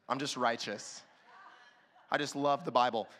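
low, deadpan mock-righteous boast; mock-pious. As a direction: say it low like a smug saint.

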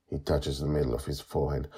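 ordinary delivery, nothing marked

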